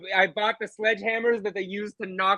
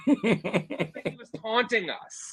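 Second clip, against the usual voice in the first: laughing while talking; 4 semitones higher, 5 dB quieter, much slower than usual. Real, not acted. Laughing at the memory.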